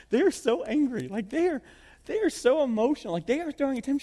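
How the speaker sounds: high-pitched exasperated complaint; hammed-up fretful venting; mock exasperation. Moses voiced complaining to God.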